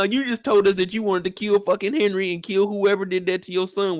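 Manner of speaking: ordinary talk, nothing marked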